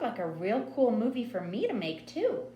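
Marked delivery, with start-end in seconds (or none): none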